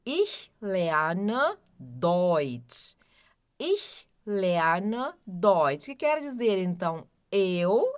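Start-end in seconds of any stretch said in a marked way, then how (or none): 0.1-5.9 Ich lerne Deutsch very slow and careful; teacher models Ich lerne Deutsch slowly; slow pronunciation drill. Shown as a demonstration. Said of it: "a primeira frase". (Ich lerne Deutsch bem devagar para repetir)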